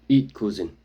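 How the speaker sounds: lightly voiced gruff warrior; grunting. A narrator's reading. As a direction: push the food on him with a grunt. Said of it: "he grunts".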